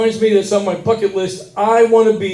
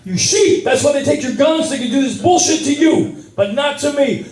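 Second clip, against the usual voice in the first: laughing, shouted tirade much higher; high pitched yelling rant; 7 semitones higher than usual. Retold or imitated. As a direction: rant back half laughing. Yelling back at American guy.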